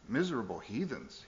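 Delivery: ordinary delivery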